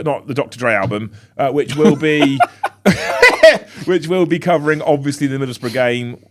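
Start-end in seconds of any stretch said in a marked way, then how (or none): 2.4-3.8 very high, loud laughing fit. Real, not acted. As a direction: crack up helplessly mid-sentence. Laughs mid sign-off.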